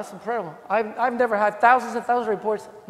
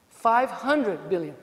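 very slow, drawn-out Five hundred billion; dragged out in disbelief; slow repetition; 2 semitones lower, much slower than usual. Shown as a demonstration. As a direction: let Five hundred billion sink in slowly. Repeating the number for weight.

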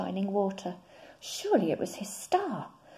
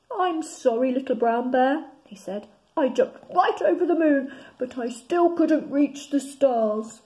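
high frog voice; 5 semitones higher, 8 dB louder than usual. Acted. Frog apologises to bear.

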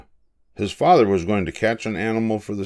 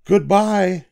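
a high, louder sing-song farewell; high calling goodbye; 12 semitones higher, 6 dB louder than usual. A narrator's reading. The animals call goodbye to Julius, high and louder. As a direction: wave off the ship with a call.

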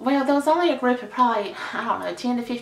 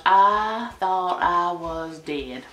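slow, drawn-out dreamy line; dramatic swoon; 2 semitones lower, much slower than usual. Real, not acted. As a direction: stretch it out like you're melting. Recalling his smile.

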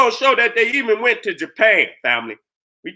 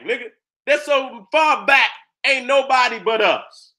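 normal talking voice

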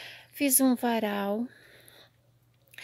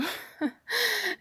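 rueful laugh; 9 semitones higher than usual. Real, not acted. Laughs at fallen shelves.

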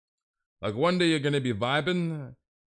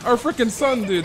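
high, loud alarmed mother; hammed-up shocked mother calling George; shocked mom; 12 semitones higher, 12 dB louder than usual. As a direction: shout to the husband in mock panic. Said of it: "And Mom's like".

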